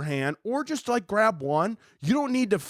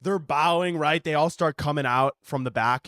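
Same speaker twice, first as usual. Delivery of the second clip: usual speaking voice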